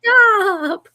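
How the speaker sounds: high, loud, crying "Stop."; whiny kid's cry. Retold or imitated. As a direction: wail it like an upset child. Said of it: "And she said".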